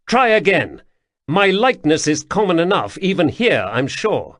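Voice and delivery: commanding high voice, clipped authority; a young prince pulling rank on a guard captain; imperious prince. Acted. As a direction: loom over him as the prince.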